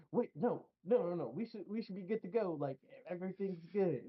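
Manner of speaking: high, hurried, flustered protest; upset. Retold or imitated. Boss retold protesting the team's refusal, high flustered. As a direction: panic a little and rush it. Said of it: "And he gets so upset".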